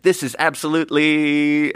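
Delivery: lightly voiced Alec Baldwin, stretched out; a hint of Baldwin weighing his words; Alec Baldwin pondering. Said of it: "and he's like".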